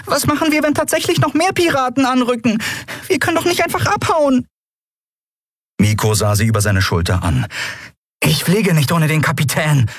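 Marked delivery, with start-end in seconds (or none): 0.1-4.5 very high, anxious protest; worried fairy voice. A narrator's reading. Tiny Nelen fears more pirates and protests to Miko. 8.2-9.9 higher, determined; a boy trying to sound firm; lightly voiced boy. A narrator's reading. Young Miko refuses to leave without the captain, firmly.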